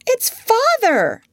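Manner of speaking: high, slow, astonished cry; girl finding her father in the kennel, amazed; surprised exclamation. Acted. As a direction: gasp it out as you recognise him. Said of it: "exclaimed Wendy".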